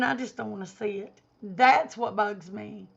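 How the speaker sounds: ordinary talk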